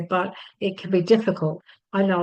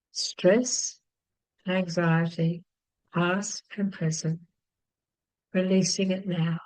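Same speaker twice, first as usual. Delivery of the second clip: guided tapping script. Acted. Teacher guiding viewers through tapping, calm soothing chant.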